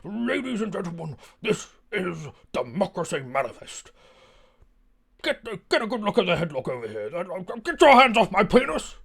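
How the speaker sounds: high, booming theatrical orator, swooping on the questions; very dramatic fellow. Retold or imitated. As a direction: imitate a hammed-up performer making a grand public protest. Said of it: "He was a very dramatic fellow".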